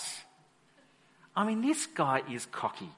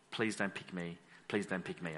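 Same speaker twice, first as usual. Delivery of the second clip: much lower and softer, pleading under the breath; scared mumble; 9 semitones lower, 10 dB quieter than usual. Retold or imitated. Frightened soldier praying under his breath, low and soft. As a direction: beg quietly not to be chosen. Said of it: "you're sitting there going".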